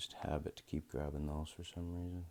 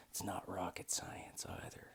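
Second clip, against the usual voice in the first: soft breathy whisper; whispered aside; 2 semitones higher, 8 dB quieter than usual. Real, not acted. Watchmaker teasing his viewers, whispered aside. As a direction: drop to a whisper for the joke.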